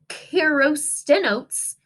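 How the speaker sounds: "Kirostenotes" drawn out syllable by syllable; slow careful name. Shown as a demonstration. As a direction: sound out "Kirostenotes" very slowly. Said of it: "Ooh, that was a big word".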